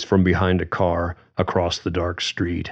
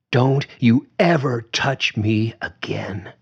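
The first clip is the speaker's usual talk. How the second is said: cold and steady; 5 semitones higher than usual. Acted. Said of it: "calm and steady, but chilled with certainty".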